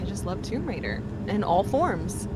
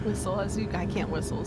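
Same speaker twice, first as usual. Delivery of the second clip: ordinary talk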